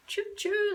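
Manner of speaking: high, slow, soft mock train whistle "choo choo"; drawn-out playful "choo choo" like a toy train; train noise. Imitating a train for friends jumping on board. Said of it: "why I added in the choo choo thing".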